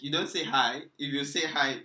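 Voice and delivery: emphatic warning. Real, not acted. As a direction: insist firmly. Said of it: "warning students".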